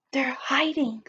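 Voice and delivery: a hushed secret whisper. Pretend. Teacher whispers to the children about the hiding dinosaurs. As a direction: whisper it so they won't hear.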